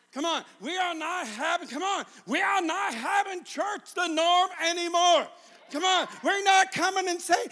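loud, high, hammered preaching shout; full-force preaching. Real, not acted. Preacher rousing the church to come back to life, shouting. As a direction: drive each phrase home to the church.

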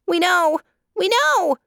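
frightened doves crying out together. A narrator's reading. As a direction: squeal it in alarm. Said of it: "cried the doves".

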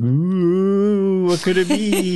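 high, airy mystery voice with a laugh; mysterious voice. Pretend. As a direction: tease it like a spooky riddle. Imitating a mysterious game clue.

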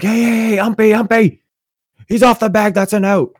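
high, loud mock yelling at an umpire; screaming protest. First baseman appeals to the ump, screaming. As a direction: holler it high, imitating an outraged player. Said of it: "starts screaming at the ump".

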